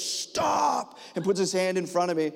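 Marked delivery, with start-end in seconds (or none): 0.0-1.0 high-pitched, drawn-out stop command; mock urgent cry. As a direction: imitate someone urgently halting a jump. Video guy halts the jump.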